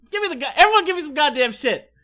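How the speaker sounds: high, loud, rapid-fire mock yell; frantic demand. A player demanding fuel from everyone around him, frantic mock yell. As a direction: fire it out fast and loud like an urgent order.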